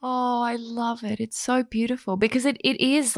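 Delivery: touched and gentle; real tenderness after tears; moved. Real, not acted. Host moved, soft and high.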